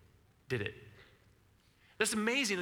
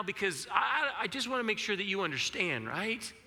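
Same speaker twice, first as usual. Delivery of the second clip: anxious prayer to God; 6 semitones higher than usual. Pretend.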